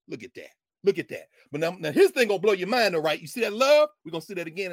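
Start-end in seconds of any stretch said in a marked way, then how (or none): 0.1-0.5 low, soft murmur; quiet marvel. Real, not acted. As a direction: say it low, almost to yourself. Repeats remark, soft then firm.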